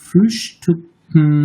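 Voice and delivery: Frühstücken drawn out, ü and final -ken clearly spoken; slow German demo. Shown as a demonstration. Teacher showing the correct German sound to students, slow. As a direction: model Frühstücken very slowly. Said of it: "আসলেই জানি".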